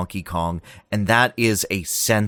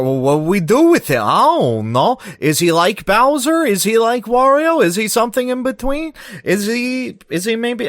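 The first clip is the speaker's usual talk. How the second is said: much higher, louder, fast flustered questions; puzzled developers; 14 semitones higher, 5 dB louder than usual. Pretend. Nintendo designers wondering what Donkey Kong should be, frantic high voice. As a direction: ham up clueless designers brainstorming. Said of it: "It's more".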